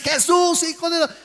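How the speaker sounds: high, urgent shouted call. Acted. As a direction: cry out for Jesus over the crowd. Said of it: "dice que comenzó a gritar". (gritando con fuerza)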